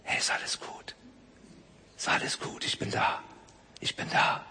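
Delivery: soft unvoiced whisper. Preacher whispering comfort to a frightened child. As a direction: breathe it close, gently comforting. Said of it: "diese flüsternde Stimme".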